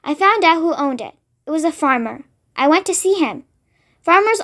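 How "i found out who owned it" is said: plain reading voice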